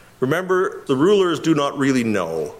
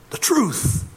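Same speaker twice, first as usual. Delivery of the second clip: "The truth" raised high and hit hard; emphatic; 10 semitones higher than usual. Shown as a demonstration. Preacher stressing the key word for listeners, emphatic. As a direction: punch the truth. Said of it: "know the truth, by the way, is emphatic".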